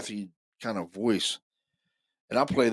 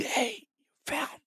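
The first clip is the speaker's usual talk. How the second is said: slow, high whispered imitation; mimicking the faint recorded voice; ghostly whisper; 4 dB quieter, slower than usual. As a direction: breathe it out like the ghost. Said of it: "another whispery voice".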